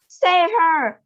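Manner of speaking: loud pleading cry. A narrator's reading. Mother cries to save her daughter, loud. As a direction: cry out for rescue. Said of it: "cried Missus Beauregard".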